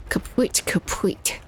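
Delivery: whispered, rueful echo of kaput. Real, not acted. Gamer muttering about lost aim, whispered kaput. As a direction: breathe the word kaput twice under your breath. Repeating word after accuracy loss.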